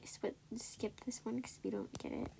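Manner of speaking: whispered aside. Real, not acted. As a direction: whisper so the listeners won't hear. Side remark to co-host.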